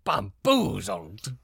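pirate voice. Acted. Pirate captain echoes.

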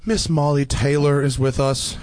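ordinary delivery

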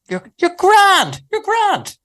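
hammed-up high Irish radio host; Ryan Tubridy impression. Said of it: "I am Ryan Tubridy".

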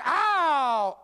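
sharp high yelp of pain. Retold or imitated. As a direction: cry out as if struck. Sudden pain in a quote.

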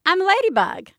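put-on high little girl voice; bright childlike announcement; kid voice. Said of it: "And she says".

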